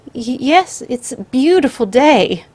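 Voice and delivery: high shaky forced cheer; nervous stammer. Acted. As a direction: stammer out small talk. Grandmother stammers in fear.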